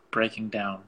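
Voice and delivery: usual speaking voice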